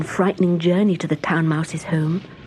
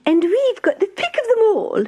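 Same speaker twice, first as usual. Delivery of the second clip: very high, louder mouse voice; boastful mouse; 3 dB louder than usual. Acted. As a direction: brag about the spoils.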